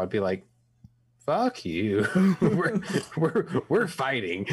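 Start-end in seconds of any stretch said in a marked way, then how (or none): 1.3-4.5 slow mock threat breaking into laughter; laughing mock defiance. Grandson jokingly defends grandpa from deportation, laughing defiance. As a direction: crack up while playing defiant.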